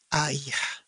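low, soft, hesitant stammer; a caught-out father stammers to the teacher; stuttering. A narrator's reading. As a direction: stumble nervously over it. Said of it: "Chimeng stuttered".

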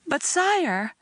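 high, stagy wail of protest. A narrator's reading. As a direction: plead in a hammed-up wail. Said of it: "I wailed".

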